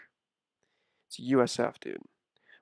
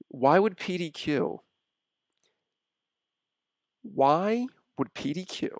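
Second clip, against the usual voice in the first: normal talking voice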